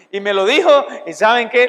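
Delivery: ordinary talk, nothing marked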